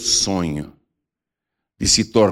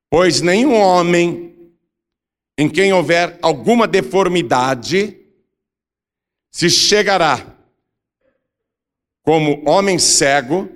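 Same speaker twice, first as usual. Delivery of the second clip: slow, raised, declamatory Bible reading; scripture proclamation; 6 semitones higher, slower than usual. A narrator's reading. Preacher reading Leviticus on future priests, solemn proclamation. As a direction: proclaim the law phrase by phrase, higher. Reads scripture aloud to congregation.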